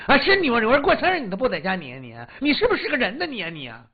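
loud, shrill tirade of a wife; furious scolding. Acted. Wife berating her drunk husband on birthday, furious shout. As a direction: yell at him like she's lost it. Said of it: "这大奶奶黄腊梅顿时就翻脸了".

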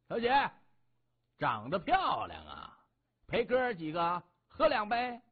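leer at her, stretching the words with swinging pitch; leering thug. A narrator's reading. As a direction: thug hitting on a woman, swaggering. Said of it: "嘿嘿地发出一声怪笑，冲着何嫣然大声地说".

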